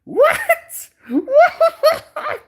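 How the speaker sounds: very high, loud squeal of disbelief; squeaky hammed-up shock; put-on cartoon voice. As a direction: shriek it, breaking into laughter. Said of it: "I almost even sound like that".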